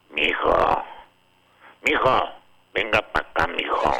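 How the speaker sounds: lightly voiced gruff father calling his son; a hint of a stern old dad, calling mijo over; imitating the dad. Co-host imitates Beto's father calling him, lightly voiced. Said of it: "voy a tratar de hablar como su papá".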